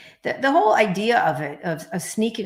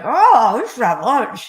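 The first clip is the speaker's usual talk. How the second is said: higher, louder mock mumbling boss; imitating Murdoch mumble; 6 semitones higher, 9 dB louder, slower than usual. Imitating Rupert Murdoch inviting her to lunch, mumbling. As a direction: play him as an eager mumbler. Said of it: "he's like".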